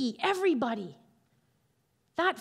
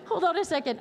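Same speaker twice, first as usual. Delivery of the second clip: laughing; 4 semitones higher, faster than usual. Real, not acted. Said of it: "She always does it".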